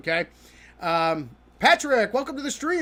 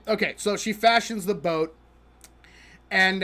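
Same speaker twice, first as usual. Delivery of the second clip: ordinary talk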